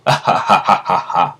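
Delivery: acted evil laugh, steady ha-ha-ha; Jay's villain laugh. Villain planning to take Dio's body, acted gloating laugh. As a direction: cackle in triumph like a plotting villain.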